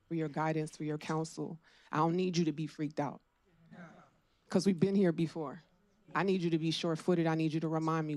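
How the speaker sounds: ordinary talk, nothing marked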